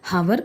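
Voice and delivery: "ഹവർ" with the H sounded; wrong pronunciation. Shown as a demonstration. Teacher tells students not to say it, "ഹവർ" with sounded H. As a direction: begin "ഹവർ" on a breathed h. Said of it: "എന്ന് പറയരുത്".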